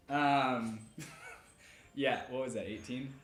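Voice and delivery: ordinary talk, nothing marked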